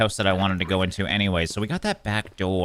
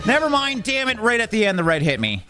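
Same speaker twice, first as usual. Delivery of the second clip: high exasperated cry; angry at the bad luck; frustrated yell; 13 semitones higher than usual. Real, not acted. Spotted at the last moment.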